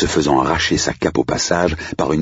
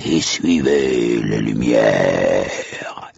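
slow, drawn-out horror host; theatrical spooky warning, very slow; menacing hellish majordomo; much slower than usual. Acted. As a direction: draw out each threat like a ghoulish butler. Plays the ghoulish majordomo's warning.

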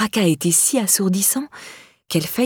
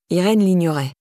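ordinary delivery, nothing marked

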